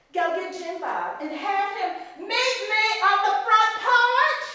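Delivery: loud, high shout across the house; shouted order. Acted. Mistress yelling to a servant to fetch Jim Bob, loud and high.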